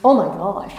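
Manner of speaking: ordinary delivery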